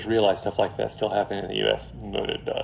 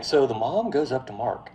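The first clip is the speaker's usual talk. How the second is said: ordinary talk, nothing marked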